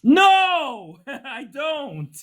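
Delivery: high-pitched, incredulous no with a laugh; exasperated denial. Real, not acted. Exasperated answer to challenging caller.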